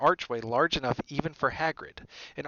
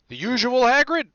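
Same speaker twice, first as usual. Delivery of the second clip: high, loud bartender's greeting; 12 semitones higher, 10 dB louder than usual. A narrator's reading. Bartender to Hagrid over the bar, high friendly call. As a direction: call it out to a regular.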